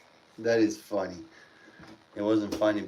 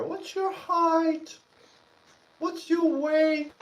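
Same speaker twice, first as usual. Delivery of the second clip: high, slow, sing-song mock of a nurse's routine questions; much slower than usual. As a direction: ape the nurse asking the same dumb questions. Mocking hospital staff questions.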